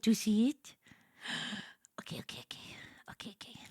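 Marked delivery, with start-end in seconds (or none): 2.0-3.6 whispered okays, hushed suspense. Real, not acted. Streamer building suspense for the reveal, whispering. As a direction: hush it like a secret.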